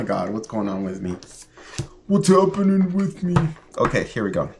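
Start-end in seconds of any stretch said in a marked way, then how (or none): 2.1-3.3 squeaky put-on voice, much higher; silly high voice. Vlogger laughing at herself, squeaky pet-talk voice. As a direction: pitch it way up like talking to a pet. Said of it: "I'm always making that voice".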